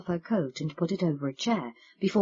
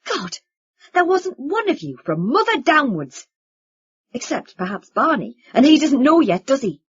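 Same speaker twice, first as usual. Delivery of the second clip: angry; 4 semitones higher, 7 dB louder than usual. Acted. Vincent's angry outburst continues.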